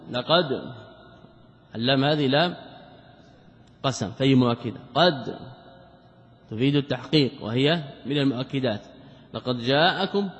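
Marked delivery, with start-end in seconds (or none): none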